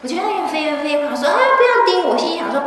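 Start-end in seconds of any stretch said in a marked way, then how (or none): 1.3-2.1 high panicked cry; much higher frightened "don't bite me!"; panicked plea. Retold or imitated. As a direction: squeal it in sudden fear of the bite.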